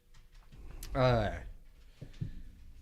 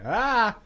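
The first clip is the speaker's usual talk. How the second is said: sudden high yelp; a sharp shout of surprise as you trip; startled yelp; 11 semitones higher, 9 dB louder than usual. Retold or imitated. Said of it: "and went".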